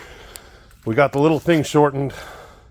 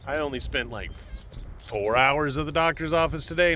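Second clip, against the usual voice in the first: normal talking voice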